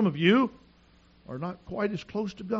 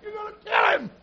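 high, loud frantic wife's cry; panicked shout; 8 dB louder than usual. Retold or imitated. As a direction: scream it as a terrified wife.

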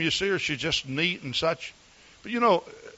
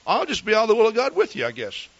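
higher, brisk wife's voice rising at the end; lightly voiced loyal wife; 7 semitones higher, faster than usual. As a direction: quote his devoted wife quickly, lifting up. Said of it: "Because she says".

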